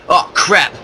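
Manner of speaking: loud startled outburst. Real, not acted. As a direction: jump at the ambush. Sudden ambush surprise.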